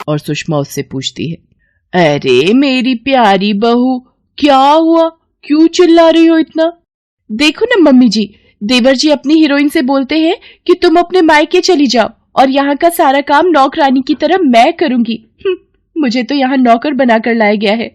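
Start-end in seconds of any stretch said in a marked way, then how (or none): 1.9-6.7 high-pitched fussy concern; fussing mother-in-law. Acted. Mother-in-law asks shouting daughter-in-law. 7.3-17.9 high, whining complaint; high-pitched, wronged tattling; aggrieved daughter-in-law. Acted.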